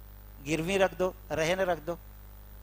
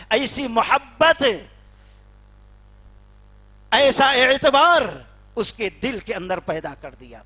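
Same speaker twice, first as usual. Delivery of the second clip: higher, louder fiery sermon peak; preaching at full force; 6 semitones higher, 10 dB louder than usual. Acted. Preacher rousing the congregation with fiery preaching. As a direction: build it into a ringing pulpit cry.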